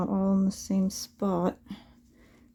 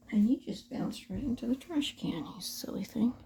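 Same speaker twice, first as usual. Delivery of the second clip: soft whispered scold; whispering; 3 semitones higher, 5 dB quieter than usual. Real, not acted. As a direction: whisper it to the needle. Scolding dropped needle softly.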